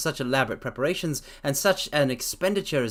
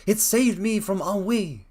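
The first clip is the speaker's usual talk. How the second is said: slow, drawn-out yawning words; 5 semitones higher, slower than usual. A narrator's reading. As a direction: speak through a yawn. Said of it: "he answered, yawning".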